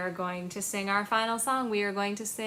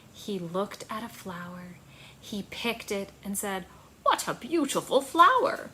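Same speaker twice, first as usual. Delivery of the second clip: put-on reading to children; 5 dB louder, slower than usual. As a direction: read it gently to little listeners. Reads a child's story aloud.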